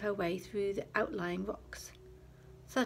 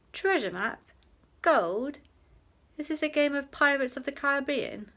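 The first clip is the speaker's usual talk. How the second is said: higher, louder mock incredulity; mock disbelief; 6 semitones higher, 8 dB louder than usual. A narrator's reading. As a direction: act innocent and amazed. Fox feigning innocence.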